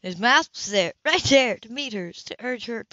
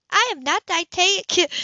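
sharply higher and louder, flustered denial; indignant protest; 10 semitones higher, 8 dB louder than usual. A narrator's reading. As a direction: bristle at being called dictatic. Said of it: "she found".

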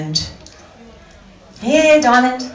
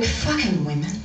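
low, soft grumbling, laughing; mock muttering survivor; 4 semitones lower, 8 dB quieter than usual.

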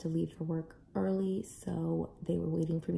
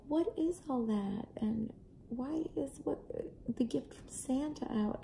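put-on curious kids; 7 semitones higher, 3 dB quieter than usual. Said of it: "They were like".

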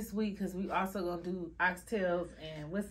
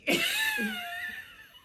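high shrieking laugh; 3 dB louder than usual. Real, not acted. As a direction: let out a squealing laugh. Too scared to eat.

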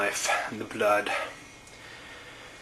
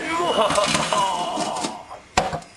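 high, falling gleeful laugh; villain laugh; 8 semitones higher than usual. Acted. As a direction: laugh like a cartoon baddie. Villain laughs after escaping.

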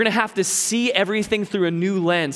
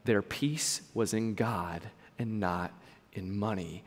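much softer, slow inner voice; quiet recalled line; 9 semitones lower, 11 dB quieter, slower than usual. Retold or imitated. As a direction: let the line echo softly in your head. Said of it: "And it was like".

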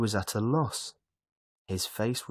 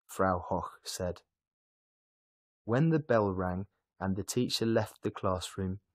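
ordinary delivery

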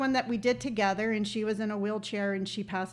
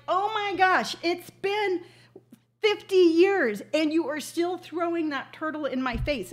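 mock exasperation; 6 semitones higher, 4 dB louder than usual. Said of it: "And I'm like".

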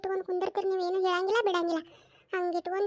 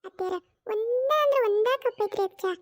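usual speaking voice

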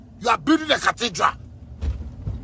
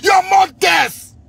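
high, slow, drawn-out angry yell; furious shout; 8 semitones higher, 5 dB louder, much slower than usual. Real, not acted. Cursing the officials who worry the youth, a drawn-out furious yell. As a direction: stretch every word out in pure rage.

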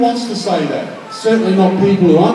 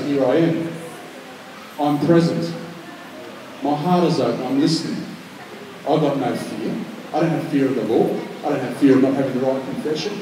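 soft, hushed and sincere, lower; quiet prayer; 6 semitones lower, 9 dB quieter than usual. Acted. The preacher voicing a believer's prayer, quiet and sincere. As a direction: pray it softly, heart open.

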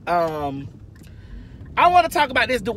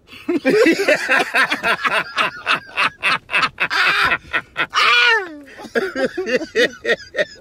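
long high cackling laugh fit; helpless laughter, pitched up and loud; can't stop laughing, whooping high; 7 semitones higher, 5 dB louder than usual. Real, not acted. Bursts out laughing on camera.

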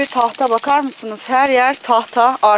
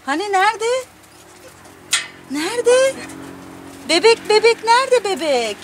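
high sing-song questioning; playful pet talk; 10 semitones higher than usual. Real, not acted. Owner urging pet to find the baby, high playful cooing.

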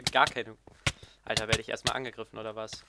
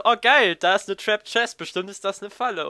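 gleeful, high and loud; mock excited inner voice; 9 semitones higher, 10 dB louder than usual. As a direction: gush over the trap chest. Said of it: "denk so". (aufgeregt gespielt)

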